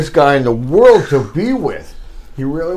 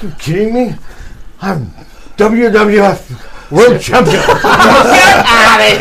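slow, loud, laughing wrestler brag; mock-proud Iron Sheik to the cop; Sheik bragging; 5 semitones higher, 6 dB louder than usual. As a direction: boast like a champion wrestler.

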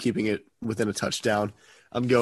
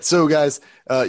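ordinary talk, nothing marked

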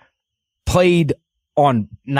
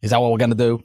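ordinary talk, nothing marked

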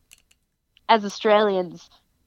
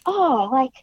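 pitched well higher, bubbly put-on fan voice; imitating American fans; 6 semitones higher than usual. Quoting American fans who ask about Australian wrestling, higher and bubbly. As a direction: play the eager American asking about Australia. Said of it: "They're like".

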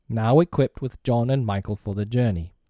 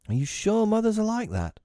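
put-on high-pitched children's question; small worried voice pitched high; anxious child; 12 semitones higher than usual. A narrator's reading. Children doubting Peter about mothers, anxious high child voice.

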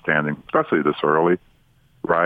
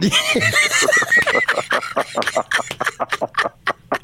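high laugh. Real, not acted. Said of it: "That would be perfect".

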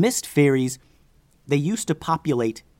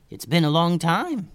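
higher, glad greeting; happy reunion; 5 semitones higher than usual. Acted. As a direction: greet an old friend with lifted pitch. Greeting an old friend.

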